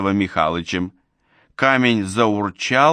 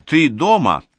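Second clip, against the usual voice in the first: raised, higher-pitched schoolmarm call; piercing teacher's call; 6 semitones higher, 4 dB louder than usual. A narrator's reading. Old neighbour calls for Seryozha at his gate, piercing teacher's call. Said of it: "закричала она пронзительным учительским голосом".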